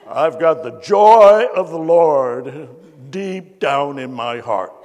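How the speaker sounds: loud, slow, exaggerated testimony voice; church lady testimony. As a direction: belt it out like a scary church lady. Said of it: "She said".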